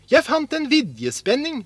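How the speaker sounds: high, eager answer; excited announcement. A narrator's reading. Askeladden tells his brothers of the willow band, excited. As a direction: proudly present a new find.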